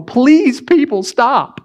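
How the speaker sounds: mock desperate plea; pleading engineer. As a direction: beg people to stop. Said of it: "train engineers going".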